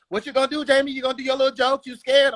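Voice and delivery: high, taunting voice of a woman; heckling woman. Retold or imitated. Woman in front row daring the comic, high taunt.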